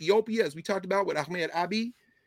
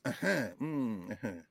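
soft, drawn-out listening murmurs; wordless affirmation; 7 semitones lower, 8 dB quieter, slower than usual. Shown as a demonstration. Visitor in Africa answering without words, soft murmured assent. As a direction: murmur assent, low and slow. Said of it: "often verbal without words".

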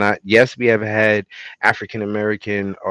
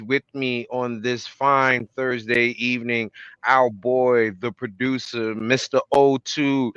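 ordinary talk, nothing marked

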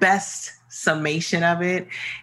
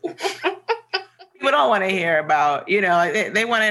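higher, louder, through laughter; laughing; 5 semitones higher, 4 dB louder than usual. Real, not acted. Joking that listeners don't care about gardening, laughing. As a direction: laugh your way through the punchline.

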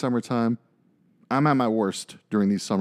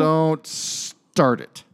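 slow, higher, stretched-out parental warning; drawn-out warning; 6 semitones higher, 3 dB louder, much slower than usual. Retold or imitated. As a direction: stretch it out as a warning to your kid. Acts out warning to his kid.

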